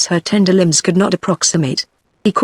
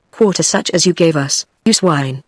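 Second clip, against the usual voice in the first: ordinary delivery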